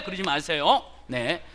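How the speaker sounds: ordinary talk, nothing marked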